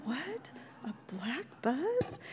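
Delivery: hushed, shocked whisper, pitch up on What; whispered disbelief at the woman's question; stunned whisper. Retold or imitated. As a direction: breathe out the disbelief. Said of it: "I'm like".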